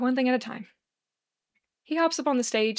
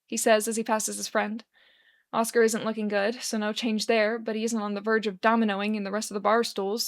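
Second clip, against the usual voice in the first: plain reading voice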